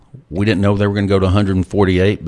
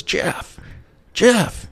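a breathy hint of a Greek friend calling, then a much higher call; calling like Christos; 13 semitones higher than usual. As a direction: call his name twice, imitating a friend across the lobby. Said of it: "I can't sound like Christos".

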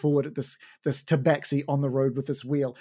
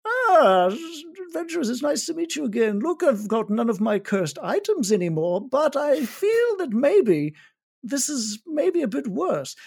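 higher, sly cat-folk voice; sly tabaxi; 10 semitones higher than usual. Acted. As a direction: play the tabaxi trader, knowing and light.

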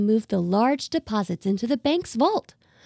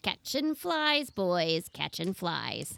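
put-on slow sing-song old lady; 3 semitones lower, 9 dB quieter, much slower than usual. Old lady sings out to the boys.

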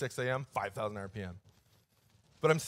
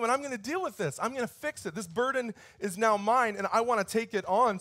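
put-on gentle, reassuring father to a crying child; tender comfort; 5 semitones higher than usual. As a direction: soothe a scared little girl.

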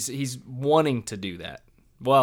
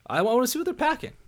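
high-pitched joking; 14 semitones higher, faster than usual. Pretend.

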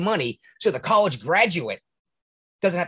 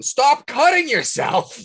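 much higher and louder, slow, fed up; exasperated shout; 11 semitones higher, 6 dB louder, much slower than usual. Acted. Telling the bleeding person off, exasperated shout. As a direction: spell it out to someone who won't listen.

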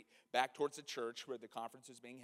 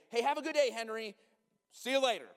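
cheerful goodbye; 7 semitones higher, 8 dB louder than usual. Retold or imitated. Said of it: "I wanted to say".

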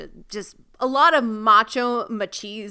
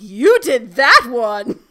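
slow, louder, mock-outraged accusing Belle; mock accusation; 9 dB louder, slower than usual. Mocking Belle's line to her co-host, slow hammed-up accusation. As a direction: drag out the blame like a bad actress. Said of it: "Belle saw the one injury on the arm and was like".

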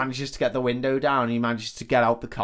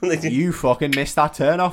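indignant passenger; 5 semitones higher, 6 dB louder than usual. Retold or imitated. Retold angry passenger confronts driver.

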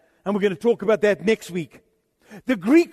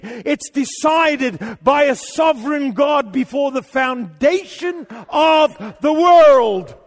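higher, louder, shouting pulpit thunder; preaching at full force; 5 semitones higher, 4 dB louder than usual. Acted. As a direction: hammer each claim home.

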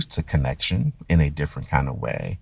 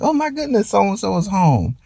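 much higher, joyful welcome-home cry; excited shout. Retold or imitated. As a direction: squeal it like a surprised relative. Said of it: "everyone just shouts".

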